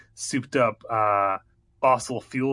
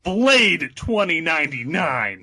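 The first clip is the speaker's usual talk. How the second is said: big slow trailer title reveal; drawn-out epic movie title; announcer voice; 6 semitones higher, 5 dB louder, slower than usual. Shown as a demonstration. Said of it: "Let me get my announcer voice".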